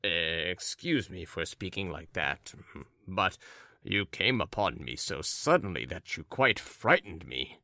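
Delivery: low, subdued apology; understated, grudging young man's apology; apologizing. Acted. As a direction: apologize softly, a little put out. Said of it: "frowning and apologizing".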